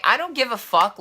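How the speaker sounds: high, loud, dismissive outburst; defiant comeback. Retold or imitated. Teller snaps back at friend over the girlfriend, defiant. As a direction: fire back as if fed up.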